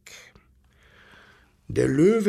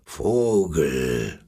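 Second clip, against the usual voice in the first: very low, growly lion voice; Löwe; 10 semitones lower than usual. A narrator's reading. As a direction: call out deep like a lion. (tiefe Löwenstimme)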